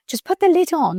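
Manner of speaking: a lightly hammed-up husband giving practical advice; husband's suggestion. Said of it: "And Joe was like".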